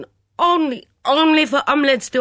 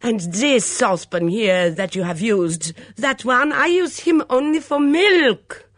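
put-on high, loud foreign-accented outrage; furious torrent; 8 semitones higher, 9 dB louder than usual. A narrator's reading. Mitzi scolding over her kitchen pans, in a furious torrent. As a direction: rant indignantly, wailing up to a peak. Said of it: "Mitzi burst into a torrent of words".

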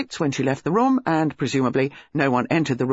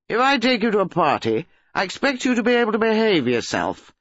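put-on higher, irate young man; scolding; 6 semitones higher than usual. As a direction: lay down the law to the girlfriend. Acted lovers' quarrel.

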